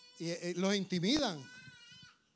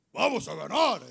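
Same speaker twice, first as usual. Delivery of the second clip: loud, full-voiced war cry; forceful team voice; 7 dB louder than usual. Team that sounds like winners, strong loud cheer. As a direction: boom it out to convince. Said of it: "tiene que buscar una voz".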